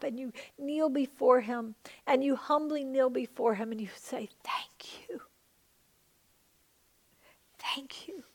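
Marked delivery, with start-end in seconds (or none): 4.4-8.2 soft breathy guided-prayer hush; hushed prayer whisper. Acted. Pastor guiding the congregation to picture God, whispering. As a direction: whisper it gently, like a secret.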